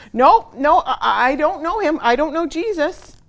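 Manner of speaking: loud, high, flustered stammer; panicked denial. Acted. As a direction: blurt it out, caught and scared. Peter's second panicked denial.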